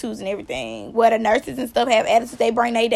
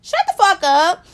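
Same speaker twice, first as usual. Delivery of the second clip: imitated yell, higher and loud, easing on the repeat; angry snap back; 6 semitones higher, 6 dB louder than usual. Kayla firing back at the nurse, angry. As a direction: cut the nurse off, pitched up. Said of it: "And Kayla".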